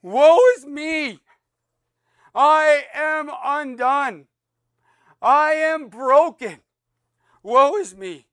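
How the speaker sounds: high, loud, slow cry of despair; anguished confession. Acted. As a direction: cry it out like a man undone before God. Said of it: "and he says".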